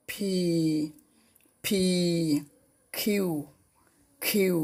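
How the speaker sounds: drawn-out letter names, "ও" gliding to ou, "পি" with a puff of air; correct English pronunciation. Shown as a demonstration. Teacher leading children in repeat-after-me, careful English letter names. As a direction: hold each letter evenly for repeating. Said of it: "আমরা সঠিকভাবে ইংরেজি বর্ণমালা".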